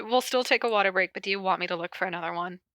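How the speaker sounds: ordinary talk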